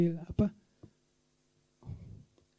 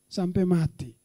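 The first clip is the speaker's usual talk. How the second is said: normal talking voice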